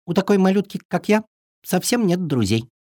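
tiny lonely bird; sad little bird voice. Acted. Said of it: "вздохнула невеличка". (грустный голосок птички)

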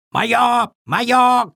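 high, raven-like squawk; cawing raven. A narrator's reading. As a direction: caw it out possessively. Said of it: "каркал ворон".

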